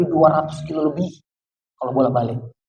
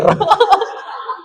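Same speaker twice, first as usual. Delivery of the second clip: laugh; 12 semitones higher than usual. Real, not acted. Laughs at his own story.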